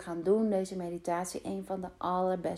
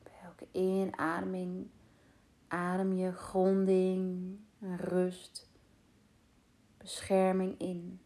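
guided meditation. Acted. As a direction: guide them calmly into rest. Leads a meditation. (rustige meditatiestem)

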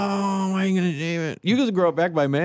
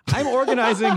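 reading through a laugh; cracking up mid-read; 10 semitones higher than usual. Real, not acted. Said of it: "I'm just reading the way it's written".

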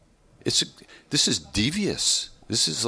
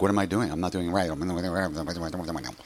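fretful muttering mind, trailing off softer; anxious inner voice; 5 semitones lower, 3 dB quieter than usual. Retold or imitated. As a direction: voice the nervous inner critic, fading away.

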